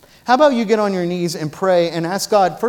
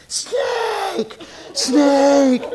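yelling snake; 9 semitones higher than usual. Retold or imitated. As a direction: shout the warning like a prank. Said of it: "they're yelling".

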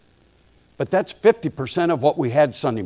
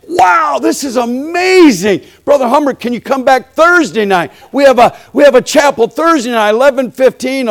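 much higher and louder, bursting with joy; overjoyed host; 9 semitones higher, 6 dB louder than usual. Retold or imitated. Jamaican host thanking the preacher, thrilled and much louder. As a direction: gush like a thrilled host who can't believe it. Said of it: "He was so happy".

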